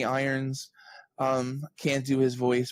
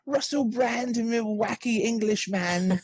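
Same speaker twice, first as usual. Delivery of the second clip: put-on hammy English accent, pitched up; Russell Brand impression; 9 semitones higher than usual. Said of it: "It was like Russell Brand".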